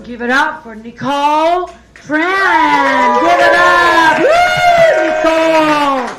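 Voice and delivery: loud, high shout building to a whoop; hyped stage introduction. Real, not acted. Host bringing on the next comedian, shouted hype. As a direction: draw the name out in a big cheer.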